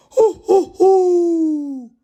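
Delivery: high, loud owl hooting; owl hoots. A narrator's reading. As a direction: hoot like a spooky owl. Owl noise in woods.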